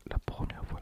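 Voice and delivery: normal talking voice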